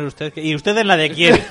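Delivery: ordinary talk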